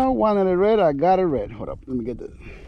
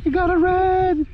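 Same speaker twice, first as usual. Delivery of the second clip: excited; 3 dB louder than usual. Real, not acted. Landing a red fish, excited.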